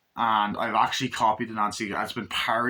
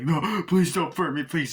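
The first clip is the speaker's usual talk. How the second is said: begging cop; 6 semitones higher than usual. Retold or imitated. Cop begs not to be burned.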